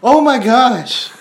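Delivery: high, loud and slow; drawn-out stunned gasp of words; stunned disbelief. Real, not acted. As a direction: let the shock stretch out. Shocked by the video.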